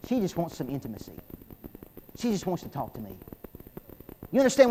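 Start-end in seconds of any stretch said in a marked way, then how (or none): 0.1-3.1 tender. Real, not acted. Tender point about his wife.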